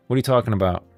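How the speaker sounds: ordinary delivery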